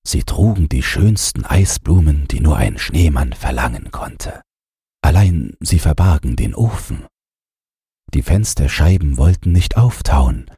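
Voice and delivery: plain reading voice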